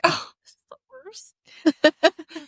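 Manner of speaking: slow, high, dramatic groan of a line; drawn-out lament. Retold or imitated. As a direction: drag it out like a tragedy. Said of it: "Mom will still be like".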